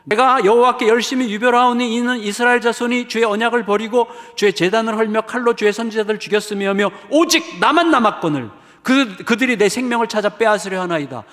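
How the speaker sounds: high, loud finger-pointing complaint; accusing Elijah. Acted. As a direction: jab the words at God. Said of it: "막 하나님을 막 삿대질을 하는 거예요".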